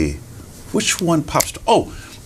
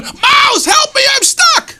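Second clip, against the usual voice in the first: very high, loud cry for help; panicked fox; 5 dB louder, slower than usual. Acted. Trapped fox begs mouse for help, panicked shriek. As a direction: scream for rescue as a trapped animal. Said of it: "And he's going".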